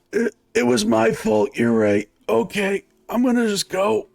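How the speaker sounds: high, backing-down injured man; intimidated injured man. Acted. Injured man yielding to armed onlookers, intimidated. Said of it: "sees all of the well-armed and bloodied people".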